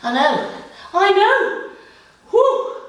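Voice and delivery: strained, breathless exertion; gasping through the burn; straining during plank. Real, not acted.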